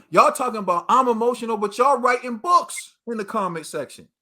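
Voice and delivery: usual speaking voice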